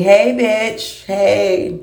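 high, mocking little taunt; a lightly voiced cheeky hair calling out. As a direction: voice the hair teasing you. Voicing the taunting chin hair.

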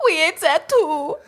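imitating a pig admitting the cake; squeaky pig voice. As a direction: whimper it like a guilty little pig. Imitating the pigs in the story.

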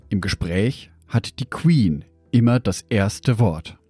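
normal talking voice

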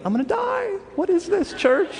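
hammed-up terror of a man sure he'll die; high, frantic mock panic. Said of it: "And I'm like".

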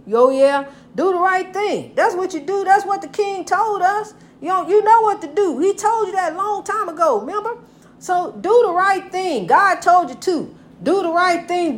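high, ringing sermon voice, pushing each line to the listener; fiery preaching. Acted. A preacher urging her viewers, fiery preaching. Preaching about doing right.

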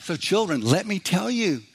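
slow, sing-song Sunday school teacher; children's teacher. Retold or imitated. Preacher imitating a Bible school teacher at story time, sing-song. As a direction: stretch it out as if coaxing little children. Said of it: "it had somebody who's teaching".

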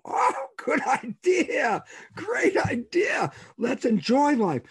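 very high, drawn-out mock delight; gleeful agreement. Man cheering on his own plan to enjoy life, gleeful. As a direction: squeal it slowly like a thrilled cheer.

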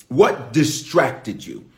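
what distracted you, slow again; slow repeat. Shown as a demonstration. Preacher repeating the question slowly. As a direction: hold it slow and heavy. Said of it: "say it another way".

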